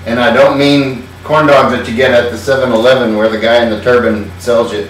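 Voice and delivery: normal talking voice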